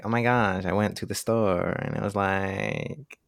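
imitated drawl, slow stretched last words; slurred sentence endings. Mocking how young women talk, slurred drawn-out endings. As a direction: drag out "store" and "like", rising. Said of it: "that little slur at the end".

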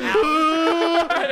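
shrill laughing yelp; high mock yelp. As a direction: squeal in mock pain. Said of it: "I'm like".

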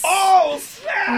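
high, thrilled shout; excited shout. Real, not acted. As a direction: shout it out in delight. Hearing of the battle.

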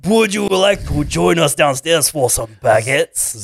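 slow, hammed-up French accent; mock French accent. Host offering baguettes as the French actress, slow mock French accent. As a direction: purr it like a cartoon French lady, French accent. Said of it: "outrageous French accent".

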